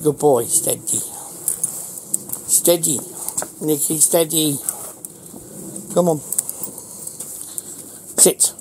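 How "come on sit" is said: ordinary talk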